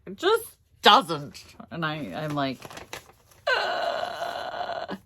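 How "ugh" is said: long, drawn-out ugh; exasperated groan. Retold or imitated. Groans in reply to husband's comfort.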